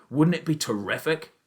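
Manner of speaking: almost shout it with joy; excited shout. A narrator's reading. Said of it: "he shouted".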